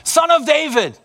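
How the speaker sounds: a high, far-carrying cry out; shouted call. Retold or imitated. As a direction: call out to him across a crowd. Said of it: "He heard someone shout out".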